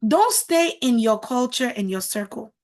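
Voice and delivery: usual speaking voice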